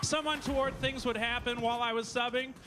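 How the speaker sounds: ordinary talk, nothing marked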